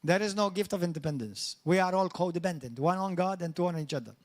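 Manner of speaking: ordinary talk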